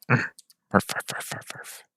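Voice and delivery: soft, voiceless barks fading away; dog barking. Acted. Another dog comes at the gate, soft mock barking. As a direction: imitate a dog with quiet huffed barks.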